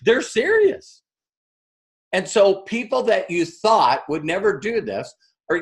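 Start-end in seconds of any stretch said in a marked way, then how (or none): 0.0-1.0 rising high, impressed; impressed surprise. Retold or imitated. Onlookers react to the Facebook Live, surprised. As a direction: sound genuinely impressed. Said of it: "everyone says".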